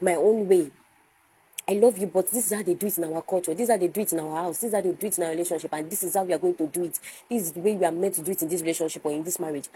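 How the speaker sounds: fast, piled-up mock demands; insistent partner. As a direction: reel off the rules without a break. Acts out an insistent partner laying down rules.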